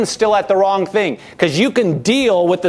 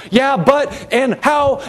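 higher, fretful "yeah, but, and how"; anxious fretting; 7 semitones higher, much slower than usual. Retold or imitated. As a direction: mimic a worried believer losing their peace. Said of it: "That's different than going".

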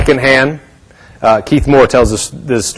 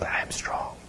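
breath-only, barely audible whisper; really weak whisper; 21 dB quieter than usual. Retold or imitated. Sick woman trying to declare strength, very weak whisper. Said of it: "but really weak".

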